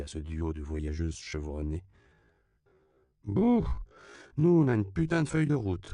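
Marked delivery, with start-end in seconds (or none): 3.2-5.9 high, loud sullen complaint; gloomy grumble. A narrator's reading. Daughter answers a question about their travels, grumbling gloomily. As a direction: open on a high boo, then grumble emphatically. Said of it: "répondit Dorothy sombrement".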